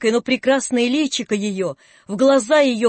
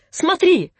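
shouted; 7 semitones higher, 3 dB louder than usual. A narrator's reading. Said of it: "и закричала ему".

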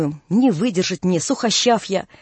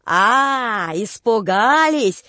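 насмешка; much slower than usual. A narrator's reading. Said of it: "А они своё".

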